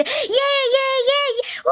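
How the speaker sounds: high mock children's shouting; mock shouts of kids playing downstairs; kids yelling. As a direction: imitate noisy kids at play. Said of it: "इतने जोर जोर से चिल्ला रहे थे".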